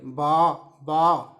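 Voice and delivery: low, very slow baas; teacher imitating a sheep. Shown as a demonstration. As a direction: bleat long and drawn out like a sheep. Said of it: "शिप का sound".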